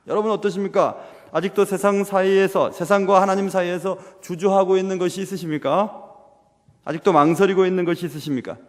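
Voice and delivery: ordinary delivery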